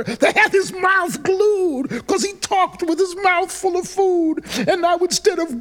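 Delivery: loud, high-pitched comic climax; worked-up narration. A narrator's reading. Telling how they glued his mouth, worked up and loud. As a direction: tell it high and loud, at full exasperation.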